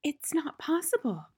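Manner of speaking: high, breathless disbelief; gasping. A narrator's reading. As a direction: gasp it out, stunned. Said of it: "Miss Honey was gasping".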